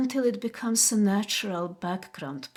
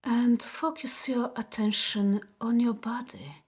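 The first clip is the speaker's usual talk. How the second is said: guided meditation. Acted. Host guiding viewers to notice body sensations, guided meditation. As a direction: guide them gently inward, unhurried, leaving space after each phrase. Leads a relaxation exercise.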